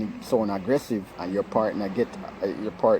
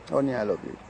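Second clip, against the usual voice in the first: an obligatory endearment after a big sigh; reluctant, sighing; 9 dB quieter, much faster than usual. Shown as a demonstration. Saying it to a partner without wanting to, reluctant sigh. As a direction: breathe out heavily, then say it grudgingly. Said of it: "you don't want to say it".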